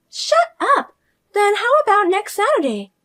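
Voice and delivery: high, loud sudden outburst; shrill snapping sister-in-law. Acted. Fiona snapping at her sister-in-law, high and loud. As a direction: cut her off sharply.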